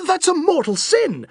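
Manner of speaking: high, outraged gasp of a priest; scandalized, pitch leaping up; shocked priest. A narrator's reading.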